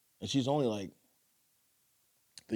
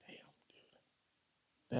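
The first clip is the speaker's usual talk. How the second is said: whispered reaction; breathless whisper; 15 dB quieter than usual. Real, not acted. Reacting to strong drink.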